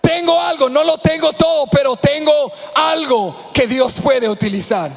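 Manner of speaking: más agudo y fuerte, gritando con fe; proclamación gritada. Acted. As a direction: grita tu fe a la congregación. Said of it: "Alguien que diga". (predicador gritando agudo)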